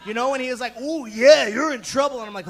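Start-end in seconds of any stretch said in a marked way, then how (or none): 0.8-2.2 loud wrestler-style threat; Macho Man. Acted. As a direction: growl a showy wrestling taunt. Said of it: "that thing that Macho Man's neck".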